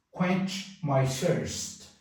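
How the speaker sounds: quench my thirst said very slowly, KWENCH stressed; slow model phrase. Shown as a demonstration. Teacher slowly models quench my thirst. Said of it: "Quench é o verbo saciar".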